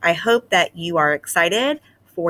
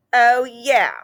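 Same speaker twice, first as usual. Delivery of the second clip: higher, louder defiant answer; defiant retort; 5 semitones higher, 5 dB louder than usual. Acted. Desmond answering Clayton's challenge, defiant. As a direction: accept the dare firmly. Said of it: "said Desmond".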